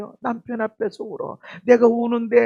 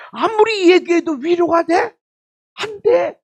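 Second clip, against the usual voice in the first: rhetorical challenge; 9 semitones higher, 6 dB louder than usual. Real, not acted.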